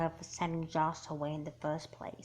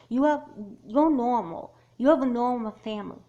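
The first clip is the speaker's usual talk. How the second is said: loud, higher, voice straining; upset outburst; 5 semitones higher, 8 dB louder than usual. Acted. Girl snapping at her friend about her normal family. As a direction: blurt it out at her, hurt and loud.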